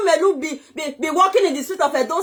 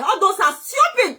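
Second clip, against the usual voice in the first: normal talking voice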